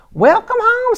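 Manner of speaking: high, sung-out welcome home; welcoming mother. Acted. Mother greets her daughter back from school, warmly. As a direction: greet her child sweetly. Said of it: "said her mother".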